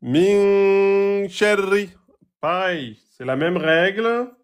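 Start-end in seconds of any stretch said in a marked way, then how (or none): 0.0-1.9 slow chanted "Min sharri" with a long held min; Quran recitation. Shown as a demonstration. Teacher chanting verse slowly for students. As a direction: intone it like tajwid recitation. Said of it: "Ici, on a quoi".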